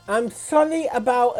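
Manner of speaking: normal talking voice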